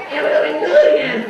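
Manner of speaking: ordinary delivery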